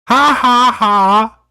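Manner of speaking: flat, sarcastic ha ha ha, not amused; a deadpan fake laugh to show it isn't funny. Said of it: "I forgot to laugh".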